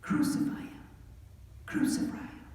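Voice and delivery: whispered, menacing chant; hissed crowd cry. Acted. The crowd answers Pilate with a whispered, menacing chant. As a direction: breathe it like a threat. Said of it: "who cried".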